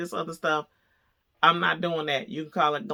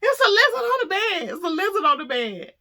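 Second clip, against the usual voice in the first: high, loud frantic repeat about the lizard; insistent yelling; 13 semitones higher, 4 dB louder than usual. Retold or imitated. Telling husband again, high insistent yell. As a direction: insist on it louder. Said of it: "And I said".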